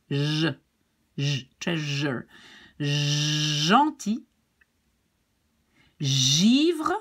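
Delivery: drawn-out buzzing zh in "J", "treasure", "Gentil", "givre"; soft zh sound. Shown as a demonstration. Teacher gives soft G examples, long zh. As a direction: lean long on each zh. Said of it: "like treasure".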